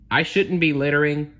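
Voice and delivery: seagull admitting fault. Acted. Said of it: "the seagull admitted".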